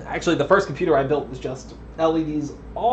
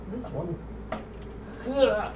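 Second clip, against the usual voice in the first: usual speaking voice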